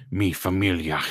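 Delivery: lightly Scottish-tinged Italian; low Connery brogue, Italian words; Italian Sean Connery accent. Retold or imitated. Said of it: "Italian Sean Connery".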